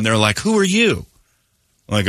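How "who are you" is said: high-pitched mock bewilderment; high, put-on blunt question; clueless stranger. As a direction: imitate a nosy stranger, voice jumping up.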